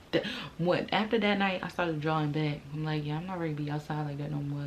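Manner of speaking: ordinary delivery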